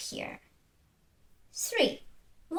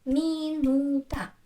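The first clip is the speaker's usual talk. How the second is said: "Minuta" slowly in three even beats; clap-along "Minuta", each syllable held apart; counting syllables; 3 dB louder, slower than usual. Shown as a demonstration. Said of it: "help you to count syllables".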